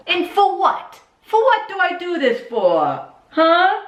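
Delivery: high, loud Jersey guy rant; Ronnie from Jersey Shore. Acted. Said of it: "turned into Ronnie from Jersey Shore".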